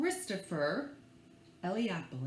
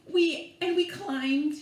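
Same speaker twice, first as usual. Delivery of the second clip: usual speaking voice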